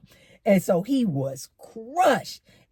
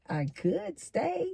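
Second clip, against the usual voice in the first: soft, slow, rising suggestion; suggestive hint; 8 semitones higher, 7 dB quieter, slower than usual. Retold or imitated. Man hinting he could stay the night, suggestive. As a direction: draw it out softly and hopefully, pitched up.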